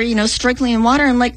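ordinary talk, nothing marked

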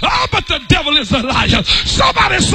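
rising, shouted preacher's cadence; fiery pulpit preaching. Acted. Preaching at full force.

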